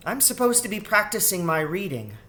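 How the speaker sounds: put-on high child's voice; piping young rat, pitched high; girl rat. A narrator's reading. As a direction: speak up like a little girl.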